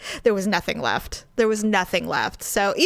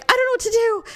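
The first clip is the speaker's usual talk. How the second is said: high-pitched helpless panic, acted out; panicked; 12 semitones higher, faster than usual. Driver on phone to husband, panicked. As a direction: retell it as a frantic plea.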